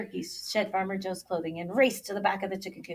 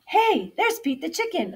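higher-pitched excited call; crying out; 9 semitones higher, 5 dB louder than usual. A narrator's reading. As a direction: cry out on spotting a friend. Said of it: "Ole cried out".

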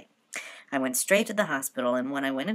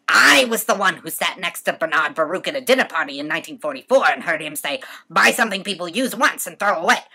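loud, indignant tirade; mother ranting about her husband, getting louder; defiant rant; 6 semitones higher, 9 dB louder than usual. Acted. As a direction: hammer home her grievances.

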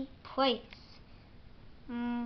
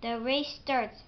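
ordinary delivery

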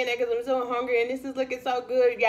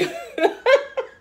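high burst of laughter; 8 dB louder than usual. Real, not acted. Laughing at own chewing remark.

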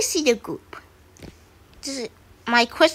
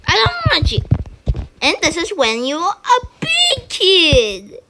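a light put-on baby voice, higher; 6 semitones higher than usual. Kid teasing the big avatar in a baby voice. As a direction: tease like a toddler. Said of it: "like, a baby voice".

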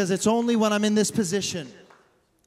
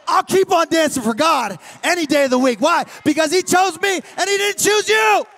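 loud, exultant retort, climbing higher; defiant David; 10 semitones higher, 9 dB louder than usual. Acted. David telling his wife God chose him, loudly defiant. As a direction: fire it back with unapologetic pride. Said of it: "And David's like".

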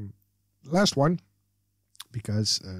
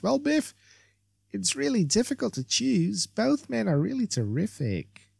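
lightly voiced ditzy valley girl; 8 semitones higher than usual. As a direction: play a bubbly airhead, lightly. Voices Barbara.

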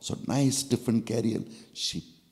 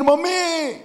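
put-on high, loud panicked child call; a child screaming for his mother; crying out in fear; 6 dB louder than usual. Boy panics at the scorpion, high frightened call. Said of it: "He cried".

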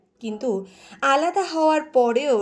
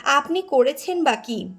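ordinary delivery, nothing marked

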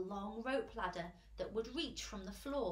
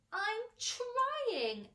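high, loud strained protest; harried poor glowworm; 12 semitones higher, 6 dB louder than usual. A narrator's reading. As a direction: protest it high, under pressure. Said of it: "answered the poor glowworm".

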